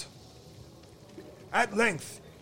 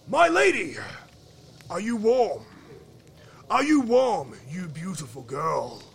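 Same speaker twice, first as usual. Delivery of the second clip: loud, raised royal questioning; insistent frost king; 6 dB louder than usual. Acted. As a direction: press the question with a monarch's force. Frost king asks again, more forcefully.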